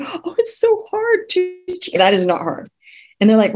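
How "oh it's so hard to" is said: mock whine. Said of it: "So when people say".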